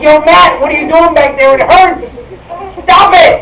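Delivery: loud pained yell, mock crying at the end; yelling at the artist. Yelling at the tattoo artist Matt, loud and pained. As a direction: yell at him to stop because it hurts. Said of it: "So I start yelling at him".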